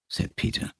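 ordinary delivery, nothing marked

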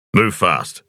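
a lightly voiced man giving a calm, firm order; steady command, no rush; calm and authoritative. A narrator's reading. Cavalier to the stunned driver, calm authoritative order. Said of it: "His voice was calm and authoritative".

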